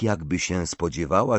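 usual speaking voice

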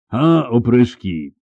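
clanging knightly roar, moderately loud; ringing roar. A narrator's reading. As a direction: roar it out at the bandits, drawn out. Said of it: "zaryczał brzękliwie jeden z rycerzy".

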